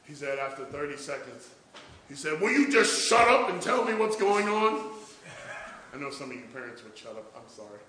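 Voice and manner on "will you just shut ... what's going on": high, sharp, impatient yell; exasperated mock yell; impatient demand. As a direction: bark it like a rude awakened man. Angry caller woken at night.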